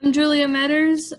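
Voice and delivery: ordinary talk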